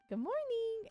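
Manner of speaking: high sing-song pet greeting; bright, lilting good morning to a pet; greeting the cat. Retold or imitated. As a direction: chirp hello to your cat. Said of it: "I walk in the room and go".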